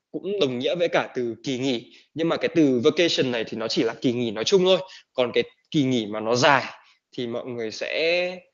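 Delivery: usual speaking voice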